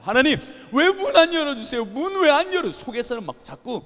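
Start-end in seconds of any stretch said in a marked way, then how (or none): 0.0-2.7 high, loud angry cry to God; angry complaint to God. Retold or imitated. Pastor shouting at God over the door, angry high cry. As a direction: demand an answer from God. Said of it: "너무 화가 나는 거예요".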